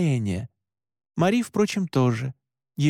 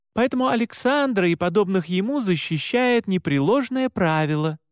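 higher-pitched, earnest woman's voice; lightly voiced Mora, insistent; 6 semitones higher than usual. Mora convincing shaken Alan, earnest and higher. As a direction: explain firmly in a raised pitch.

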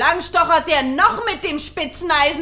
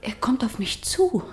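whisper; 5 dB quieter than usual. Acted. Villain approaches the fire.

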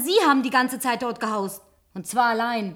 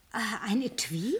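surprised whisper; 5 dB quieter, slower than usual. Acted. Surprised by gift.

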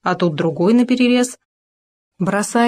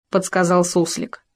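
plain reading voice

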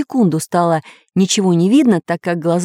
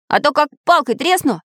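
high-pitched determined warning; resolute threat; 8 semitones higher than usual. A narrator's reading. Iron man threatening strangers with a stick, resolute high warning. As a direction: threaten firmly in a raised voice. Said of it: "решительно заявил железный человечек".

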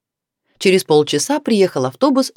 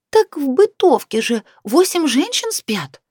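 Nina remembering; 10 semitones higher than usual. A narrator's reading. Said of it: "вспомнила Нина со второго этажа".

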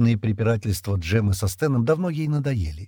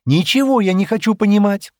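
loud, high-pitched rebuff; cutting refusal; 11 semitones higher, 8 dB louder than usual. A narrator's reading. Ширра refuses Джемма's explanation, cutting her off. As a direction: shut the discussion down angrily. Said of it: "отрезала Ширра".

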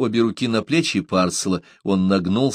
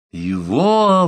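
high, louder, drawn-out cry; astonished exclamation; 8 semitones higher, 5 dB louder than usual. A narrator's reading. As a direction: exclaim it, stunned by the news. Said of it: "воскликнул Парсел". (изумлённое восклицание)

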